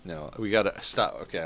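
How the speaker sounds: ordinary talk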